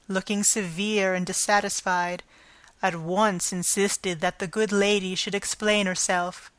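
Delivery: plain reading voice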